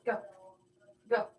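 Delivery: normal talking voice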